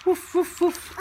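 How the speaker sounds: high mock puppy barks; puppy woof. Shown as a demonstration. Mom answering what the puppy says, high barks. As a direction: bark like a little dog for the child. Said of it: "what does the puppy say?".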